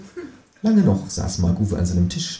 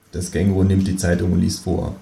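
usual speaking voice

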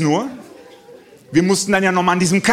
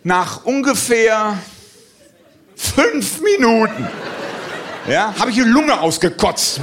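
mock breathless; 4 semitones higher, much slower than usual. Exhausted after trampolining.

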